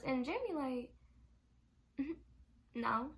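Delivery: ordinary delivery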